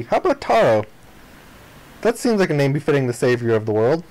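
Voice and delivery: usual speaking voice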